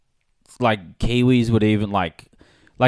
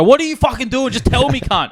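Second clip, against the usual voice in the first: high, mock-furious yell; yelling ship captain; 13 semitones higher than usual. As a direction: bark at the sailor like an angry dad. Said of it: "captain of that little ship is just fucking go".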